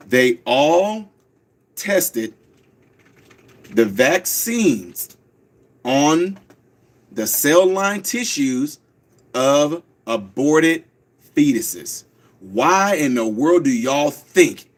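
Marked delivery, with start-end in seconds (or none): 0.1-12.0 slow, low, each word spaced out; said real slow. Shown as a demonstration. Host repeats it slowly for the audience. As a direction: spell it out slowly for the listeners. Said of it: "I'll say it real slow".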